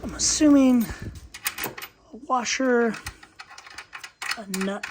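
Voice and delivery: short of breath from bending over; strained breathing while bent over. Real, not acted. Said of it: "Sorry, I'm bent over, so I'm having a hard time breathing".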